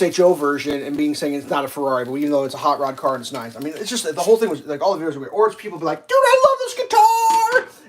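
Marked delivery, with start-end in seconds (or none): 6.1-7.8 very high, loud, drawn-out gushing; hammed-up ecstatic squeal, slow and stretched; mock freak-out. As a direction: shriek it like an overexcited fan. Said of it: "they're just freaking out, loving it to death".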